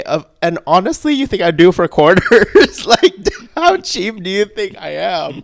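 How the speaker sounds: loud high laughing indignation; laughing outrage. Real, not acted. Telling the listener about the quarters, loud laughing outrage. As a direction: laugh through the insult of it.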